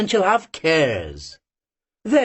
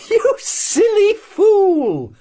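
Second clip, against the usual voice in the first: high, loud and slow, out of breath; breathless exclamation; 13 semitones higher, 6 dB louder, much slower than usual. A narrator's reading. Ustenka scolding Marianka, breathless and loud. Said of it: "She exclaimed, quite out of breath".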